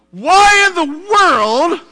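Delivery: high, loud bewildered angel voice; confused angel. Retold or imitated. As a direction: play a baffled angel in heaven. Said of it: "I would imagine angels are, like, confused".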